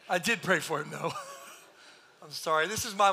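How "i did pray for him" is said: ordinary talk, nothing marked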